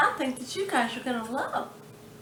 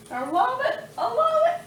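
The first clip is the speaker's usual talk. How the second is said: excited squeal; 11 semitones higher, 4 dB louder than usual. Real, not acted. Delighted about leftovers.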